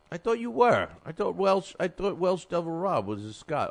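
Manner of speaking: high, puzzled "I thought"; confused surprise. Real, not acted. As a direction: caught out by the Welsh and Scot mix-up. Host confused about Welsh guest.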